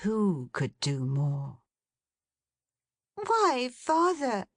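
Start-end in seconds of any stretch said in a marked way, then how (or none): 3.1-4.5 Meg crying out. Acted. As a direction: call out to father in surprise. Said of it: "cried Meg after a pause".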